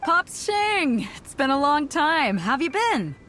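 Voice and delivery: high, delighted hail; hearty greeting. Acted. Captain greeting Pops after a long time, delighted. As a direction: greet an old friend with gusto.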